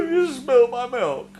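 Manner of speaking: high, loud, slow mock-blubbering child; hammed-up crying kid, dragged out. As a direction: wail it like a toddler whose milk got spilt. Mock toddler over spilt milk.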